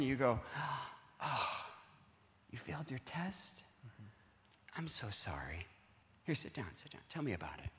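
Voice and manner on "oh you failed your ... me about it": soft, hushed comfort, partly whispered; soothing parent. Acted. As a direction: hush and console the upset child. Parent comforting failing daughter.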